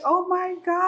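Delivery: high-pitched mock wail, without real tears; retold tearful overwhelm. New mom alone with her baby at home, retold tearful overwhelm. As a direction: imitate being suddenly overcome. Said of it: "I just started crying".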